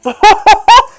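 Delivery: high, loud real laugh; loud burst of laughter. Real, not acted. Speaker laughing at own discovery, loud outburst.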